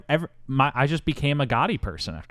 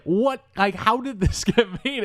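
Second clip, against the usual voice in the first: incredulous; 11 semitones higher than usual. Retold or imitated. Disbelief at the movie's existence.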